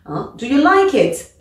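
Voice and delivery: closed question, rising tune. Shown as a demonstration. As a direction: voice climbs at the end of "do you like it?". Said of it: "l'intonation monte".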